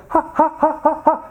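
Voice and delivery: high, staccato fake laugh; imitated in-your-face laugh. Filipino pastor laughing at crying elders, imitated laugh. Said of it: "he would laugh right in their face".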